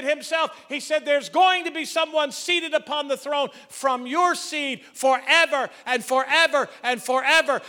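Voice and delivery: high, loud preaching that builds to a shout; full-force preaching. Acted. Preacher rousing the congregation about Jesus' throne, loud and soaring. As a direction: lift the room to the throne.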